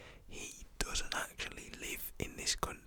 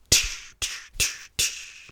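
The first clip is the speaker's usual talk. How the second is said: whispered crash noises, sharp and louder; car crash sound effect; 11 dB louder, much slower than usual. Shown as a demonstration. Imitating the crash for viewers, a hissed sound effect. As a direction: spit out a bad mouth-made crash effect. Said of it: "my car crash sound effects then were terrible".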